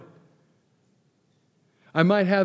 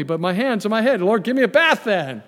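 eager Peter; 6 semitones higher, 6 dB louder than usual. Retold or imitated. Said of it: "Simon Peter said".

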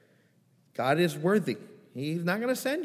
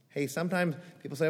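normal talking voice

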